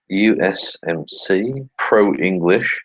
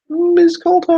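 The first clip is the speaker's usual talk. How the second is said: very high, louder mocking squeal; high-pitched voice; 7 dB louder, slower than usual. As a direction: shriek her name in a silly falsetto. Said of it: "I keep doing that high-pitched voice".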